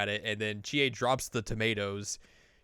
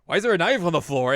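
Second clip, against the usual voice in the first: alarmed question; 7 semitones higher, 10 dB louder, faster than usual. Acted. Yu finds a knife in chaos.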